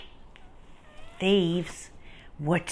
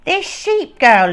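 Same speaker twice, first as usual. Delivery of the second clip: gruff thief voice; 9 semitones higher, 10 dB louder than usual. Acted. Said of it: "Luther said".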